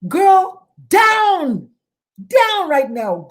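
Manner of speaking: a therapist urgently ordering a mother to her son; firm command. Retold or imitated. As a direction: snap her into action right now. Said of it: "I said".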